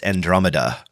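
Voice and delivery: Andromeda said an-DROM-uh-duh; standard pronunciation. Shown as a demonstration. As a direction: say Andromeda with a short o and "muh-duh". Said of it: "generally in, uh, the astrological terms".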